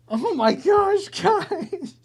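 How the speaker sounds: thin, drawn-out high squeak; very high-pitched exasperation; high squeal. Real, not acted. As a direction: squeak it out slowly, fading away. Overwhelmed by chat's requests.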